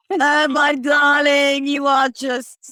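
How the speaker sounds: hammed-up slow, loud British accent; bad British accent. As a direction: ham up a posh British darling, slowly. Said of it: "Can I attempt a bad British accent?".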